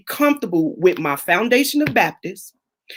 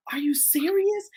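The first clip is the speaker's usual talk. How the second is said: higher breathless whisper of disbelief; incredulous whisper; 8 semitones higher, 7 dB quieter than usual. Retold or imitated. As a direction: hiss it under your breath in shock. Incredulous reaction to online critics.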